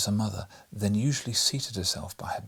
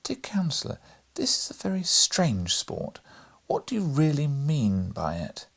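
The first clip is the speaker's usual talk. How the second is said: higher, earnest woman's voice; very serious; 6 semitones higher than usual. A narrator's reading. Mother challenges the counsellor's strange sport, very serious. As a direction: question him seriously, with mild reproof. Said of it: "their mother was very serious and said".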